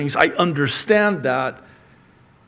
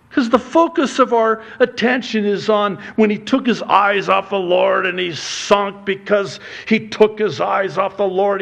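raised, higher preaching voice; 7 semitones higher, 3 dB louder than usual. Acted. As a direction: lift the congregation, preaching it out. Sermon rises to full preaching.

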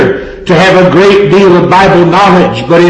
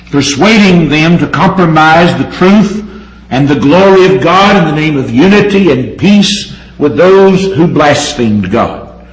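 normal talking voice

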